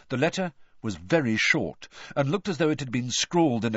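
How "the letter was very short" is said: ordinary delivery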